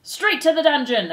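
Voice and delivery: loud, high mob cry; shouted. Acted. Another mouse demands the dungeon, shouted. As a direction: shout it from the crowd. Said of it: "another voice shouted".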